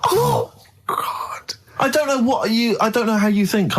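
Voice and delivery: breathy exasperated high; half-whispered disbelief; exasperated groan. Real, not acted. Exasperated at Carl.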